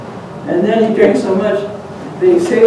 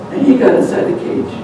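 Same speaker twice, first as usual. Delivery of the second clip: ordinary delivery, nothing marked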